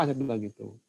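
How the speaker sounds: ordinary talk, nothing marked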